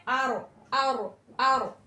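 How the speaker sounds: आरो with a thick, full-mouthed R; heavy Ra demo. Shown as a demonstration. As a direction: drawl आरो slowly, heavy R. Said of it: "यहाँ पे रॉ को हमें मोटा पढ़ना है".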